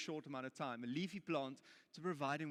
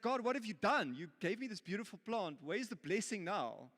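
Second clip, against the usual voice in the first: angry complaint; 5 semitones higher, 3 dB louder than usual. Acted. Jonah angry at the dead plant.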